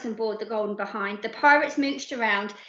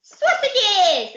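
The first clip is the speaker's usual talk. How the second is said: put-on high, squealing toddler voice; baby voice; 10 semitones higher, 6 dB louder than usual. Baby tells the pirates what he wants in a squeaky baby voice. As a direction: blurt it out with childish glee. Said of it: "he said".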